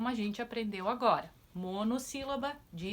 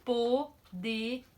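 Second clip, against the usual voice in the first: Poderia stretched slowly and louder, po-de-ri-a; syllables drawn out; 2 semitones higher, 4 dB louder, much slower than usual. Shown as a demonstration. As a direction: sound out Poderia for the class. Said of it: "quatro sílabas".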